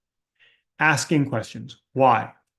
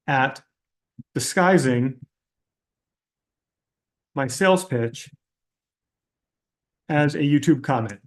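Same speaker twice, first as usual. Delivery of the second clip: mock sincere question. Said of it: "Here's the real question".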